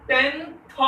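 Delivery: high, loud "टेंथ" ending in th, rising; stressed th ending. Shown as a demonstration. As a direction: punch "टेंथ", sounding the final th. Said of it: "टीएच लग जाता है". ("टेंथ" ज़ोर से, आख़िर में th के साथ)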